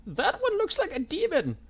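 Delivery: put-on high, slow, trembling old man; quavering voice. A narrator's reading. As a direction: stammer in fear. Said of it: "in a quavering voice".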